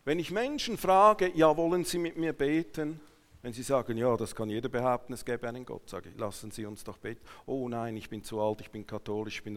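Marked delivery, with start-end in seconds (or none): none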